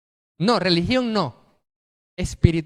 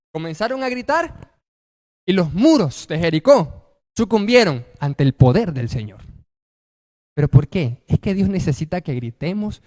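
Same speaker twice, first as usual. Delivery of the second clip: ordinary talk, nothing marked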